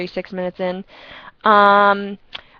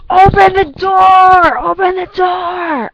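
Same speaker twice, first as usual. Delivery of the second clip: loud high slow open the door; mock drunk shouting at the door; imitated drunk yelling; 10 semitones higher, 10 dB louder, slower than usual. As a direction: bellow it like a drunk locked out. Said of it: "he kept on saying".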